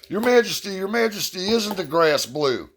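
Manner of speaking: high, pleading little donkey voice, slowing at the end; the donkey bounces up and begs the king; eager donkey. Acted.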